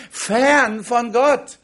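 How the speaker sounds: ordinary talk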